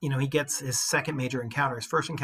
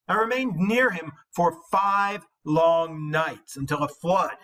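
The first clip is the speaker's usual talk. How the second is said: higher, louder, slow proud reply; confident hero's boast; 4 semitones higher, 6 dB louder, slower than usual. Acted. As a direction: answer the insult with calm pride. Reading Beowulf's reply.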